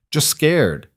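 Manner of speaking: put-on light Russian-accented "just scared", leaning on "scared"; heavy Russian accent. A narrator's reading. Bodyguard coaching a frightened woman, heavy Russian accent. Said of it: "in his heavy accent".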